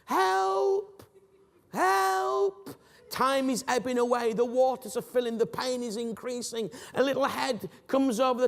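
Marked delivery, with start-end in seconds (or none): 0.0-2.7 high, drawn-out calls for help; desperate cries for help. Pretend. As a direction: cry up from the hole, desperate. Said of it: "You resort back to".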